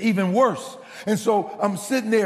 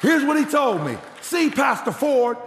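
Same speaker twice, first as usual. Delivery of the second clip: preaching at full force; 5 semitones higher than usual. Acted. Pastor retelling the elder's lesson to his congregation, full-force preaching. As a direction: build it up like the pulpit climax.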